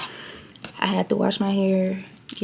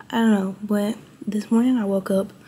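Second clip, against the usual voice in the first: ordinary delivery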